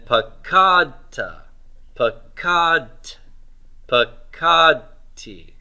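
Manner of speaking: pakadta, pakad, pakadti slowly, each with a long KAD; stress on root two. Shown as a demonstration. Teacher recites the paradigm, pakadta, pakad, pakadti slowly stressed on KAD. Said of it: "we're stressing root two all the way through".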